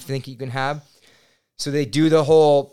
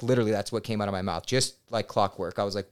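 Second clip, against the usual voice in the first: ordinary talk, nothing marked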